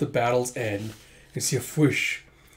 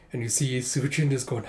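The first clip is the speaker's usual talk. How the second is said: ordinary delivery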